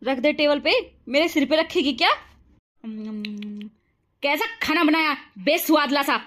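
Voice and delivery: mother-in-law taunting the bride once her son is gone; harsh mother-in-law. Acted. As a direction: sneer and boss her around.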